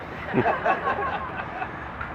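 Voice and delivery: helpless soft laughter; quiet breathy laugh. Real, not acted. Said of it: "can't even say it without laughing".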